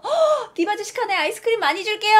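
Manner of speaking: gasp, then high, quick gushing; high-pitched fangirling shop lady; excited ice-cream lady. Acted. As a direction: squeal on recognising a celebrity customer. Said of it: "아줌마가 날 알아보신 거야".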